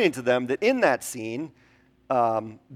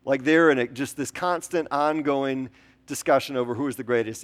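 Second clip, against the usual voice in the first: ordinary delivery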